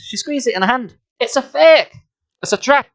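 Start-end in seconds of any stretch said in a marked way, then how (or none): 1.2-2.9 put-on high, loud child's exclamation; exclaiming. A narrator's reading. Lauren revealing the fake rock, exclaiming. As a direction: call out the discovery in a high voice.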